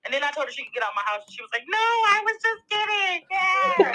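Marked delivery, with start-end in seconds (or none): 1.7-3.9 high-pitched imitation of a child backpedalling; retold pleading child. Mother mimicking her kid who was told to get out, high child voice. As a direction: mimic your kid taking it back, pitched way up.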